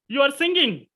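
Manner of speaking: "you are singing" pitched high, seeking an answer; questioning tune. Shown as a demonstration. Teacher showing a statement that expects a response, questioning tune. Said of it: "we express in our tone that something".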